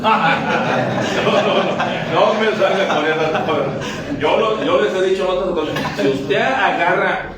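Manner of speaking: talking through laughter; laughing. Real, not acted. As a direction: laugh it off while scolding. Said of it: "se ríen". (regañando entre risas)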